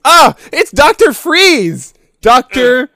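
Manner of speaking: very high, loud shout; excited shout. Acted. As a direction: yell the name out loud. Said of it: "No, I like that".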